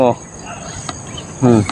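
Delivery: ordinary delivery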